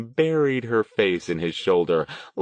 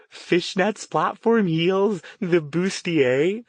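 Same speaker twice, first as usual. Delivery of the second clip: put-on high, gleeful voice of a delighted young woman; excited young woman; 11 semitones higher, 3 dB louder, slower than usual. A narrator's reading. Verity to Stone, high and gleeful. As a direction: savor each item, thrilled.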